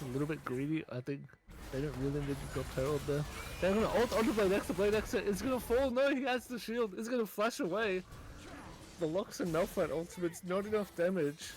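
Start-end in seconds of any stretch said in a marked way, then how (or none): 3.6-11.5 hyped play-by-play. Acted. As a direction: shout the skirmish as it happens. Live teamfight call, fast.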